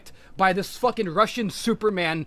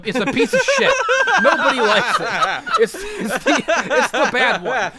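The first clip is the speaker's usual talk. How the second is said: laughing; 3 semitones higher, 4 dB louder than usual. Real, not acted. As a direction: laugh it off scornfully. Laughing at the bad sequel.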